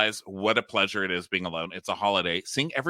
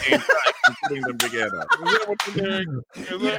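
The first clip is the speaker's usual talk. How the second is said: teasing voice; 10 semitones higher than usual. Pretend. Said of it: "teasing voice".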